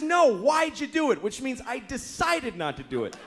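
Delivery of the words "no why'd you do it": a hint of a pressing wife, raised up; insistent wife. Wife grilling husband about the dishwasher, lightly voiced insistent. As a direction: push the question back at him. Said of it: "But she says".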